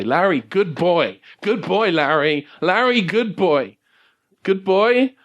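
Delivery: high, simple talking-dog voice; slow, swooping doggy praise; Sergeant Pupper. Acted. Dog urging a limp Larry, high eager doggy voice. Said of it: "hear Sergeant Pupper say".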